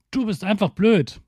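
put-on high, childish insult; mock schoolyard jab. Shown as a demonstration. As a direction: fling it like a kid in a fight. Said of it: "dass du nicht sowas sagst wie".

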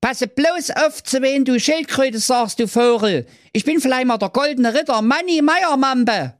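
very high cheeky knight. Acted. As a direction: pipe it out boldly and cheekily. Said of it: "rief keck".

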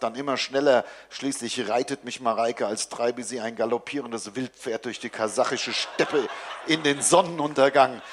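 usual speaking voice

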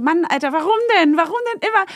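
high-pitched mock despair; whiny, exasperated self-questioning; exasperated inner voice. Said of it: "Und du denkst dir so".

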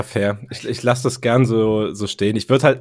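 ordinary talk, nothing marked

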